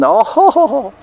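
very high, laughing ho-ho; mothers laughing. Retold or imitated. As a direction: laugh like the amused village mothers. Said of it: "they can't help but laugh".

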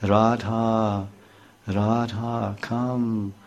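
soft, falling, drawn-out summons; the flute softly calling Radha to come; soft beckoning call. Retold or imitated. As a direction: lure her gently from far away. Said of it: "was calling".

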